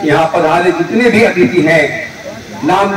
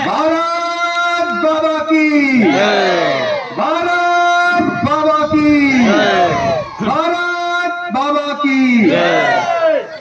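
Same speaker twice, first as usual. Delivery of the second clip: long drawn-out rally shout; slogan shout; 6 semitones higher, much slower than usual. Real, not acted. Leads crowd slogan chant.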